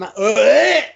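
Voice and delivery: imitated grunt rising high and loud; Big Show's grunt. Wrestler lining up a third chop, imitated loud grunt. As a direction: repeat the big man's wordless grunt. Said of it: "Again with the same".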